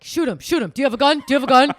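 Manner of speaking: loud, high panicked yelling; gamer yelling. Retold or imitated. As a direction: yell at the game. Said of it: "hearing you in the other room being like".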